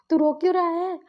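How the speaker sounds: high, loud acted outburst; alarmed demand. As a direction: demand an answer, voice jumping up. Brother demands why he is crying. (ऊँची, तेज़ आवाज़ में सवाल)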